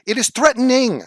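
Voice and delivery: high, loud exasperated insistence; angry insistence. Real, not acted. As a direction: snap it out in frustration. Rant at chat.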